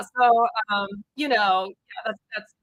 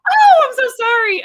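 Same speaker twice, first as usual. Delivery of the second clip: startled apology; 11 semitones higher, 10 dB louder than usual. Retold or imitated. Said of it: "she opens the door and goes".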